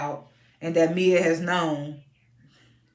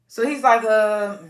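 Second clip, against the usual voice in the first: ordinary talk, nothing marked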